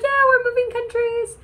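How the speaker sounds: very high squeaky admission; hammed-up falsetto excuse; sheepish retold reply. Acting out sheepish reply to agent.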